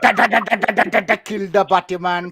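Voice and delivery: hammed-up Jamaican dancehall chant; Jamaican dancehall impression. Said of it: "my impersonation of dance hall from the nineties".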